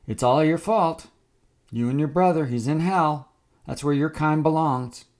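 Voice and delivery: loud, high hateful warning; menacing shout. Acted. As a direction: bark the warning at him. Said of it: "Wade continued to warn him".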